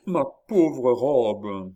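loud, slow, drawn-out wail; distressed lament. A narrator's reading. Countess lamenting her dress, wailing. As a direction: mourn it at full voice. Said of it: "rappelée à la terrible réalité".

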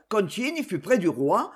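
ordinary delivery, nothing marked

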